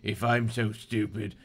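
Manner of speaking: lightly done Cockney villain; acted Cockney menace. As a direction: threaten in a put-on Cockney accent.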